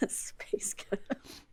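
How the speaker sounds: slow whisper of Scottish "Space ghettos"; whispered Scottish accent. Shown as a demonstration. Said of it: "they don't say space ghettos".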